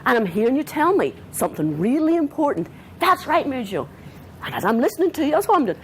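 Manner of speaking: intense, high-pitched listening voice; holding the energy. Shown as a demonstration. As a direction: meet an upset person at their high pitch. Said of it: "I'm holding the energy".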